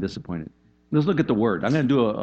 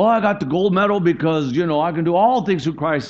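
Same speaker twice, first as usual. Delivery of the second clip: mock Olympian boast; 6 semitones higher, 5 dB louder than usual. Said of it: "quoted by the Olympian".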